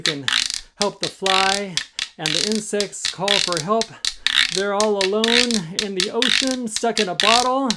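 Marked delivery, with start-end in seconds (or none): none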